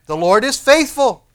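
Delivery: loud, high ringing declaration; emphatic assurance. Acted. Preacher answering doubt of dependability, emphatic assurance. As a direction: overrule the doubt with full force.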